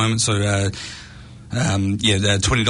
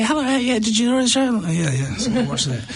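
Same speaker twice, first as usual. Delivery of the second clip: much higher, bright post-show voice; octave-higher voice; 13 semitones higher than usual. Retold or imitated. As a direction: speak an octave up, still buzzing. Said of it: "my voice is a, an octave higher".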